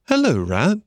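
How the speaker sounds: a hint of a high-voiced Mole; lightly voiced Mole. A narrator's reading. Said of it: "said the Mole".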